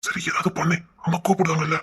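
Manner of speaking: hushed whisper. Acted. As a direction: breathe it so the others can't hear. Aside to her brother.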